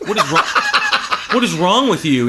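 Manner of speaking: incredulous, laughing through it; exasperated laughing rant; laughing disbelief. Retold or imitated. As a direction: laugh at how dumb this is. Mocking friend's band post.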